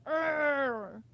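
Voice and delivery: short playful pirate-like growl; a hint of a defiant roar; mock growl. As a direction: growl like you're taking power back. Taking power back growl.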